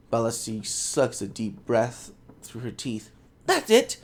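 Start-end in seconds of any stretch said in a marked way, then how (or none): 3.5-4.0 sudden high excited exclamation; excited cry. Acted. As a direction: burst out high with eager discovery. Scholar's eager breakthrough.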